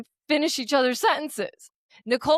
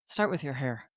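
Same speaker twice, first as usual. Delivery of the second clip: mock gentle; 8 semitones lower, 7 dB quieter than usual. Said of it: "I didn't even say it that nice".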